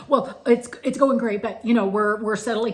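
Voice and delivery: loud, high, flustered reply; flustered stressed tourist. Retold or imitated. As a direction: blurt it out all wound up and stressed. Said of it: "And it's like".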